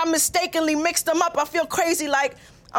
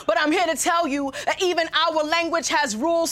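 ordinary delivery

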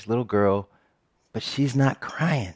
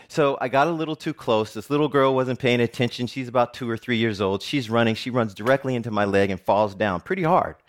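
ordinary talk

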